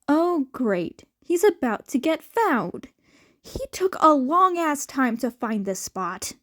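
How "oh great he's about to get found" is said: usual speaking voice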